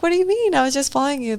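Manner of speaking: high, bewildered protest; mock innocent surprise to the cops; retold confusion. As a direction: act baffled, as if you did nothing wrong. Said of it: "I'm like".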